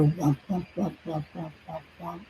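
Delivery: soft repeated womp, fading to a near-silent hush; drifting womp sounds, fading out; imitated fire womps. Shown as a demonstration. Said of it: "when we hear fire".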